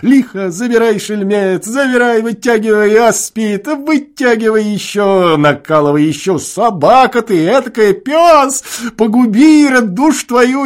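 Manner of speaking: high, loud egging-on shouts; rowdy tavern whoops; whooping cheers. A narrator's reading. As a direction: cheer the singer on, hollering. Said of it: "покрикивать".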